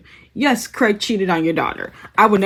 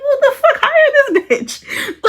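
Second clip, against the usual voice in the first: much higher, louder, half-laughing outrage; outraged disbelief; 4 dB louder, slower than usual. Retold or imitated. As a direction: squeal it out in laughing disbelief, slowing down at the end. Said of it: "I'm like".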